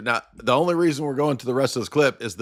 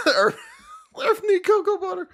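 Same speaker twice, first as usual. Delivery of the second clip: laughing. Real, not acted. High laughing joke repeat.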